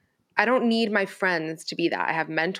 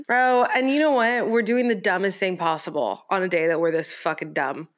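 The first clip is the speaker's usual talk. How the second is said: normal talking voice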